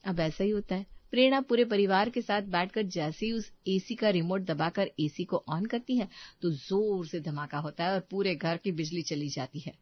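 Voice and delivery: plain reading voice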